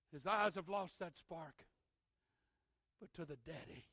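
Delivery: ordinary talk, nothing marked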